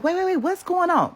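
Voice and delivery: high, startled rush of questions; imitating the friend's alarmed voice.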